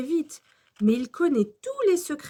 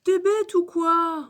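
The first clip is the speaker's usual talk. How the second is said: slow, higher, louder grumble; Alfred ronchon; 6 semitones higher, 5 dB louder, slower than usual. A narrator's reading. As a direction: grumble crossly at a classmate. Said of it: "ronchonne Alfred". (râlerie d'enfant, appuyée et lente)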